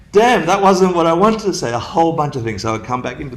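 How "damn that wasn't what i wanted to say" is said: panicked realization. Retold or imitated. Boy realizing his mistake.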